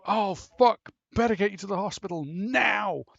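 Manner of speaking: high, loud frantic outburst; panicked yell. Retold or imitated. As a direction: swear and rush him out in panic. Headmaster panicking.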